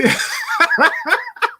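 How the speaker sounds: sudden very high laughter; laughing. Real, not acted. Cracking up over rival fans mid-sentence, high laughter. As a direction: break off mid-thought into cackling.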